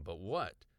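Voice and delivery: ordinary delivery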